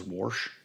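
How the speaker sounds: warsh, an R slipped in before the SH; regional pronunciation. Shown as a demonstration. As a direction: say warsh with an added R. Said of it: "area of the country that says".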